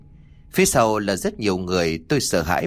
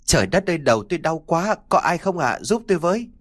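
high-pitched, restrained cry for help; mildly acted wail of pain; 7 semitones higher than usual. A narrator's reading. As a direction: plead through pain, pitch climbing. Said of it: "làm tôi không thể nào chịu được mà hét lên".

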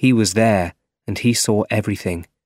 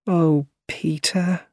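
slow, higher-pitched, disappointed sigh of a name; sad; 7 semitones higher, slower than usual. A narrator's reading. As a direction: let down, sad and drawn out. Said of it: "she said sadly".

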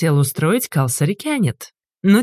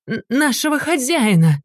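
high, breathless stammering cry; spluttering; 7 semitones higher than usual. A narrator's reading. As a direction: choke on the words in panic. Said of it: "захлёбываясь".

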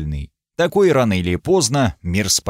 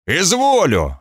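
sharp higher bark of a king; barked command; 6 semitones higher than usual. Acted. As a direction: snap the single word out. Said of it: "рявкнул король". (рявкнуть по-королевски)

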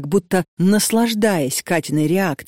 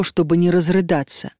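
ordinary delivery, nothing marked

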